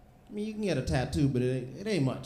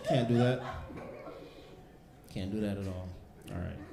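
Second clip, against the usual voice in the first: ordinary delivery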